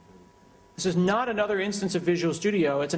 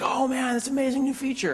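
mock amazement; 8 semitones higher than usual. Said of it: "everyone's like".

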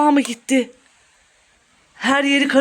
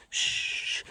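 soft "shh"; hushing shush; 13 dB quieter than usual. Pretend. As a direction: hush someone while hiding. Hushing partner while hiding.

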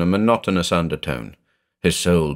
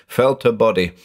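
plain reading voice

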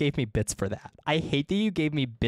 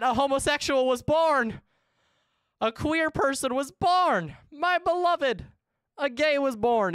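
excited proclamation; 10 semitones higher than usual. Real, not acted.

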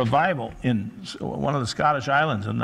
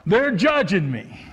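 high, loud, slow whine; mock whiny excuse; 6 semitones higher, 7 dB louder than usual. Preacher mocks people who flee church in a loud, high whine. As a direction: whine like a sulky excuse-maker.

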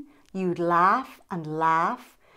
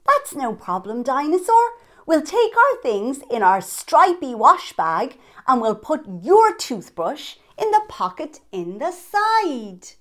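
put-on cheerful child voice; 5 semitones higher, 6 dB louder than usual. Child reassures Dinosaur.

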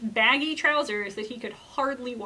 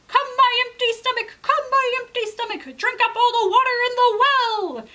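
high, loud rooster chant; rooster calling out; 11 semitones higher, 8 dB louder than usual. Acted. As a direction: summon the magic stomach like a spell. Rooster calls on his magic stomach.

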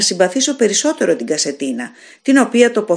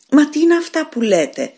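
high, startled exclamation; exclaiming in disbelief; 8 semitones higher than usual. Acted. Shop owner exclaims at the dying stranger, startled. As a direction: cry out, unable to believe it. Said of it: "αναφώνησε ο καταστηματάρχης".

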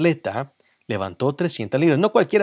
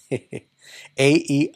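normal talking voice

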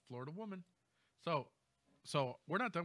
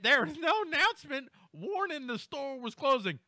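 mocking quote; 11 semitones higher, 7 dB louder than usual.

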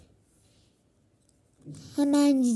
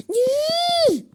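shrill why; 12 semitones higher, 5 dB louder than usual. Pretend. Protest again.

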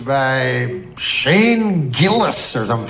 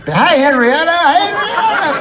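mock-friendly squeal, much higher and louder; fake-sweet hello voice pitched way up; sudden fake-sweet high greeting; 5 dB louder than usual. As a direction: switch to a gushing high greeting. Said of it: "she's like".

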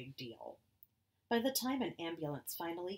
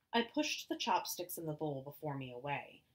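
usual speaking voice

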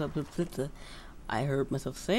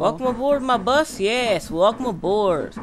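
hoch, laut und langsam, wie ein Busfahrer; begrüß die Fahrgäste feierlich gedehnt; Durchsage des Busfahrers; 5 semitones higher, 8 dB louder, slower than usual. Pretend. Greeting passengers again.

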